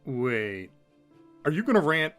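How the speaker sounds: ordinary delivery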